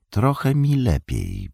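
mildly livelier, slow and high; perkier. A narrator's reading. Frodo tells Sam he feels a little better, perkier. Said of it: "powiedział raźniej".

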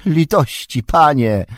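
moaning plea. A narrator's reading. Wormtongue moaning for mercy before the king. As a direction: beg for mercy. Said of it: "jęknął gadzi język".

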